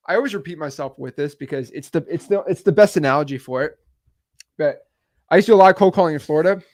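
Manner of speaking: ordinary delivery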